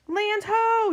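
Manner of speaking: high, loud, drawn-out hail; lookout's yell. A narrator's reading. First mate sighting land, high loud yell. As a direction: sing out a sighting to the crew. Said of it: "yells First Mate Callie".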